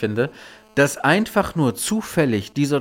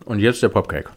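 eating; 4 semitones lower than usual. Real, not acted. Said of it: "Ein bisschen A S M R-mäßig".

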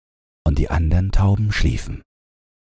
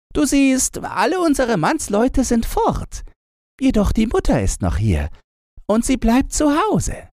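put-on higher, quick conspiratorial girl; scheming robber girl. A narrator's reading. Robber girl tells Gretchen her plan, high quick voice. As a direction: plot the escape eagerly.

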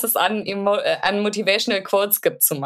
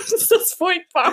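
laughing; 11 semitones higher than usual. Real, not acted. Laughing at own quote.

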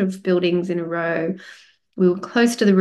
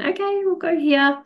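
cheerful; 10 semitones higher than usual. Retold or imitated. Quoting their happy decision.

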